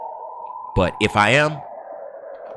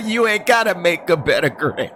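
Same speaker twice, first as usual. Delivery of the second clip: laughing through the joke; high laugh, laughing words; 9 semitones higher than usual. Real, not acted. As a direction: burst into laughter. High laugh then laughing line.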